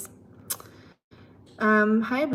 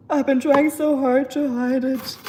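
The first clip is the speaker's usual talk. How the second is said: hammed-up despair, a bit louder; mock dismay; 3 semitones higher, 5 dB louder than usual. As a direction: playfully caught out and dismayed. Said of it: "I'm like".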